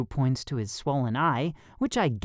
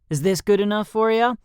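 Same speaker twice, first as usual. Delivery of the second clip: higher, louder cheesy question; cheesy showing off; 8 semitones higher, 5 dB louder than usual. A narrator's reading. Flexing for the trainer, cheesy and showy. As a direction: ham it up through a cheesy grin. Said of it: "before I flash a cheesy grin".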